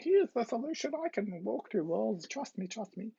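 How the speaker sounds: put-on high-pitched voice; pretend faker. Faker fooling the green person, high mock-persuasive voice. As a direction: plead in a high, silly voice.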